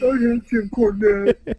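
mock outraged fan yell; mock fan rage. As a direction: rant like an angry old-school wrestling fan. Mock wrestling fan hate.

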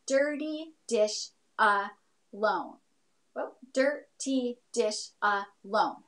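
slow, syllable by syllable; counting syllables. Shown as a demonstration. Teacher counting her haiku's syllables, slow and spaced. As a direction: tap out each syllable slowly. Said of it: "we could count out those syllables".

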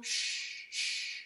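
soft unvoiced hush; hushing shh. Shown as a demonstration. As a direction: hush the snow so it doesn't fall. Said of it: "And we sh, sh, two times".

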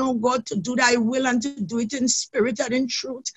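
ordinary talk, nothing marked

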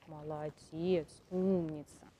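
slow, soft praise to a horse; soothing praise. Real, not acted. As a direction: coo it slowly. Praising horse after carrot.

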